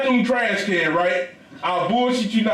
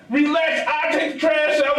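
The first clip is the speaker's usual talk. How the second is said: exasperated shout; 8 semitones higher than usual. Retold or imitated. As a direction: yell it to stop her. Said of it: "I'm like".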